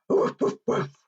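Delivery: airy, short puffed woofs; pretend barking. Presenter in a dog mask for children, pretend barking. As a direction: bark like a playful dog.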